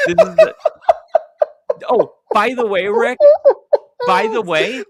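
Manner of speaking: very high, loud laughing squeak; laughing falsetto. Retold or imitated. Mocking teammate over money joke.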